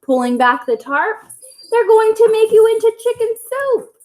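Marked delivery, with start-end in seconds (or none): 1.7-3.9 high, louder alarmed warning. Acted. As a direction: warn them in alarm.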